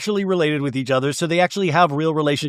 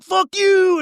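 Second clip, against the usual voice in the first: put-on high, loud drunken yell; mock furious yell; 4 dB louder than usual. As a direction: yell it like a wasted brother losing it. Brother yelling at dirt hill.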